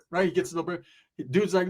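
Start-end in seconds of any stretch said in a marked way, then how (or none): none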